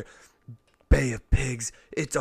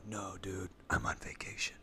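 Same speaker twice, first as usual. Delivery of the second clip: soft whispered brush-off; whispered refusal; 4 semitones lower, 11 dB quieter than usual. Acted. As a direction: whisper it lazily. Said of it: "And you go".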